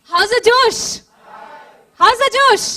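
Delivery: building crowd shout. Real, not acted. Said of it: "let me see how many of you are awake".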